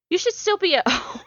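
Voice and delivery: real laugh bursting through, pitch shooting up; breaking into laughter. Real, not acted. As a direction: crack up midway through reading the line. Cracks up while reading the line.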